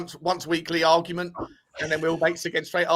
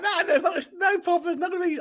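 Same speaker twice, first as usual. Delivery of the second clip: much higher squeaky mock voice of a guest; mock denial; 14 semitones higher than usual. Teasing two guests who deny any row, much higher mock voice. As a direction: mimic them insisting there's no problem, pitched way up. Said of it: "both of them are sort of coming out".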